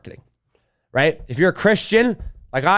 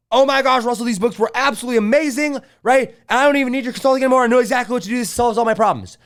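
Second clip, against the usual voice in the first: higher, fast, excited client gush; 6 semitones higher than usual. Retold or imitated. Retold client gushing thanks to Russell, excited and fast. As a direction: gush like a thrilled former client.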